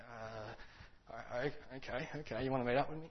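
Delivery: hushed, halting, unsure stammer; very quiet. Retold or imitated. Depressed man answering an unexpected call, quiet and halting. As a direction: mumble it softly, barely daring to answer. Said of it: "he's very quiet".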